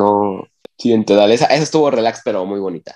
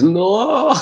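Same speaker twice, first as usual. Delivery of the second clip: dismayed no; 11 semitones higher than usual. Retold or imitated. Dream cut off, dismay.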